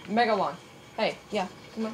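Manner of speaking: ordinary talk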